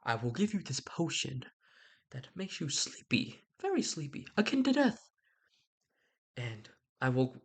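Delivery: lightly voiced friar, hushed then rising; a hint of the wise old friar confiding his plan; scheming friar. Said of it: "which is like".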